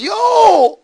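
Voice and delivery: imitating a loud, very high holler; retold yell. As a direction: call out as the man hollering into the woods. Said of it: "he starts yelling to me".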